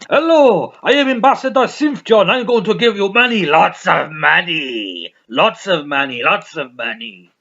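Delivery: loud, high, hammed-up ambassador voice, slowing at the end; mock ambassador. Mocking a celebrity ambassador to viewers, loud and high. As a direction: ham up a grand charity ambassador promising cash. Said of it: "They go".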